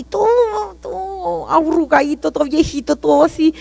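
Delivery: quiet, near sobbing; tearful. Real, not acted. Describing her aged father in hell.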